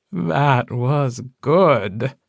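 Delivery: very slow, drawn-out mock praise; slow taunt. A narrator's reading. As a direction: stretch each word out tauntingly. Mocking villain concedes before firing.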